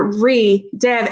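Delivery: "re", a pause, then "dev"; pause between syllables. Shown as a demonstration. Teacher showing how a pause marks the prefix, "re" set apart from "dev". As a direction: split "re" from "dev" with a clear gap. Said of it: "I hear that pause there".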